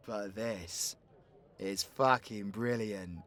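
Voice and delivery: low, soft, savouring it; quiet realisation. Acted. Outlaw seeing an opportunity in the bounty, quietly pleased. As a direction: drop right down after the rant.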